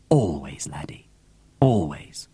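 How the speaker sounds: low unvoiced whisper of always; whispered promise. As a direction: breathe it softly to him.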